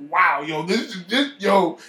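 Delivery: high, loud, thrilled rush; excited. Retold or imitated. Telling himself something big is coming, loudly excited. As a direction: burst with excitement about what's coming. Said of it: "I was excited".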